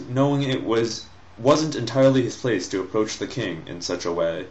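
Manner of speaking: ordinary talk, nothing marked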